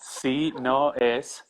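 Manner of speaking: very slow, one word at a time; staccato. Shown as a demonstration. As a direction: spell it out word by word. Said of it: "Can you say one word at a time?".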